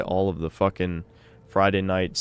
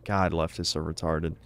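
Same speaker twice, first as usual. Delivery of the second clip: ordinary delivery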